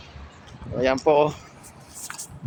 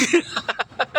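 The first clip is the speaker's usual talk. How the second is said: shivering laugh; 7 semitones higher than usual. Real, not acted. Laughing at the cold.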